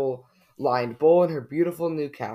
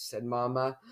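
plain reading voice

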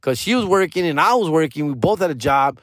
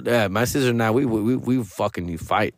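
ordinary talk, nothing marked

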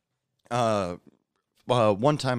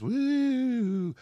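dizzy woo. Retold or imitated. Imitating dizzy coworker leaning on things.

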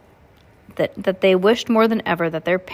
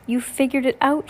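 lightly voiced aunt; 6 semitones higher than usual. A narrator's reading. Aunt found crying in cave.

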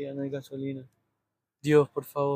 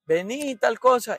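mock call across the house, higher and louder; a hint of a parent yelling for the kid; 6 semitones higher, 5 dB louder, slower than usual. Parent calling the kid from the other room, mock yell.

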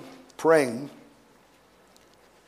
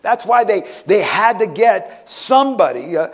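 urgent, driving sermon delivery; impassioned preaching; 8 semitones higher, 4 dB louder than usual. Acted. Preacher telling church of the arrest in Gethsemane, impassioned. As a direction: build the story with rising intensity.

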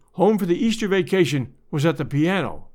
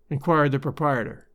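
usual speaking voice

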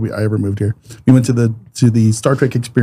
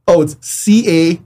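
put-on Canadian accent; 4 semitones higher, much slower than usual. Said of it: "they got that Canadian accent".

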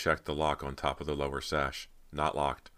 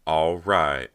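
loud burst of enthusiasm; excited cry; 9 dB louder than usual. A narrator's reading. Rob seizes on the escape plan, loud excited cry. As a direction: shout agreement eagerly. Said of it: "Rob cried excitedly".

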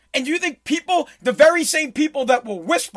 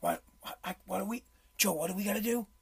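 much softer mocking whisper; 4 semitones lower, 14 dB quieter, faster than usual. Mocking the president's whisper while watching the clip. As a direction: whisper back as a joke.

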